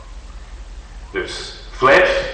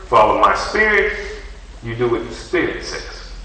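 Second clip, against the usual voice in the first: usual speaking voice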